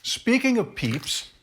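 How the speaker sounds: high, slow sing-song. Real, not acted. Sudden bright sing-song.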